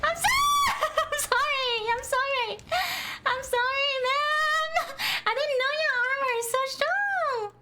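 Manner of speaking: high-pitched laughing shouts of sorry; laughing apology. Real, not acted. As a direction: yelp sorry through real laughter. Hit teammate by accident, laughing.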